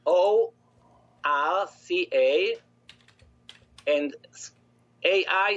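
slow, letter by letter, O R C A; spelling it out. Shown as a demonstration. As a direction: spell O R C A slowly, then A I. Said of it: "No, no, no, no".

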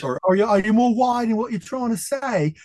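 high, mock wide Cockney accent. Talking about London class accents, mock Cockney. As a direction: play up a broad Cockney accent. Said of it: "do you speak proper?".

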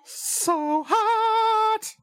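squealing. Acted. Teasing the introduced guest.

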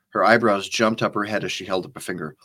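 ordinary delivery

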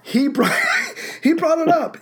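real laugh breaking into high-pitched words; laughing while talking. Real, not acted. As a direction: say it through a laugh, pitch jumping up. Laughing at friend's gut punch.

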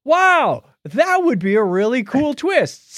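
mock enthusiasm. Real, not acted. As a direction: oversell the excitement as a joke. Sarcastic reaction to movie scene.

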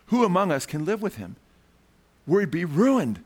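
ordinary talk, nothing marked

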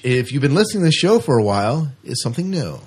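ordinary talk

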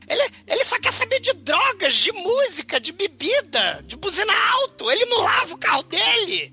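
put-on man hamming up a nagging old mother; Monty Python old lady. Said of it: "a galera do Monty Python imitando mulher, imitando a velha".

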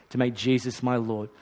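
ordinary talk

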